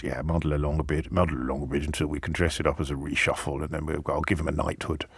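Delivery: low, soft, offhand muttering plotter; casual mock PM. Host mimics the prime minister's shrugging plan, low and hushed.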